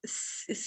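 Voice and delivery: very soft unvoiced hiss, teeth close together; whistling hiss. Shown as a demonstration. Teacher showing the hiss before the vowel: soft whistle. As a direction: let air whistle through the teeth. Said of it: "سیٹی کی آواز کے بعد".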